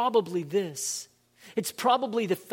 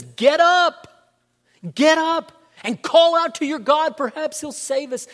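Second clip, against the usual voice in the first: higher, louder call to wake a sleeper; urgent captain; 8 semitones higher, 9 dB louder than usual. Acted. Ship captain shaking Jonah awake in the storm, urgent and loud. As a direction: rouse the sleeping prophet, urgently.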